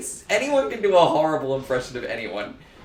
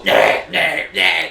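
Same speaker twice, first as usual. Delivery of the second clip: bad impression; 3 semitones higher, 7 dB louder, slower than usual. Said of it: "and they go".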